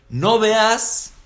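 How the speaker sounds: high, loud call of NO VEAS; loud command. Acted. Rabbi warning his congregation, loud high call.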